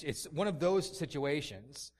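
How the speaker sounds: usual speaking voice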